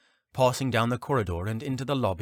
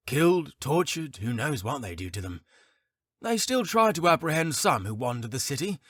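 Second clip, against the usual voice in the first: higher, chatty tavern keeper voice; gossiping barkeep; 7 semitones higher than usual. Acted. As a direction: play the wary innkeeper sharing rumours. Barkeep speaks at length.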